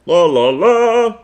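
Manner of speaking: high, loud, slow sing-song cheer; Captain Underpants impression. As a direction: sing it out like a goofy cartoon hero.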